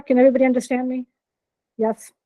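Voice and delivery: ordinary talk, nothing marked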